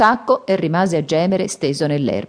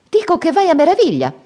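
high, loud delighted praise; glad exclamation; 13 semitones higher, 5 dB louder than usual. Acted. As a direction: exclaim with joy. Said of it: "esclamò lieto Tripitaka". (esclamazione lieta, voce alta e forte)

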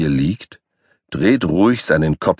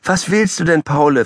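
voiced much higher, puzzled; high asking voice; 11 semitones higher than usual. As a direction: ask the hopping dog. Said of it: "fragt die Petersilie".

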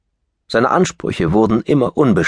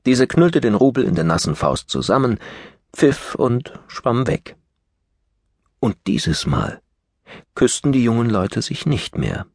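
plain reading voice

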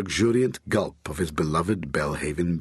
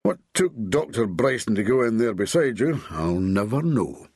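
ordinary delivery

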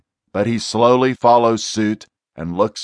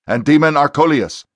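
louder, higher commanding voice; stern command; 5 semitones higher, 4 dB louder than usual. Acted. Exorcist ordering the demon to the front, stern command. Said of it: "Tom commands".

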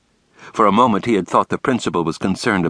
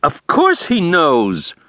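higher, slow, offended insistence; indignant; 10 semitones higher, 3 dB louder, much slower than usual. A narrator's reading. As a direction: take offence and drag it out. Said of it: "said Brooks indignantly".

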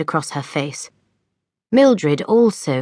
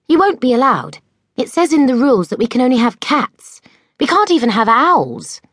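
anxious warning; 8 semitones higher, 3 dB louder, faster than usual. A narrator's reading. Mildred warns Enid about rules.

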